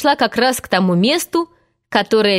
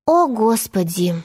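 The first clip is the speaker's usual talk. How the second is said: slow, weary sigh of words; sighed exclamation. A narrator's reading. Wendy exasperated by the noisy children, sighing. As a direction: sigh it out slowly. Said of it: "вздохнула Венди".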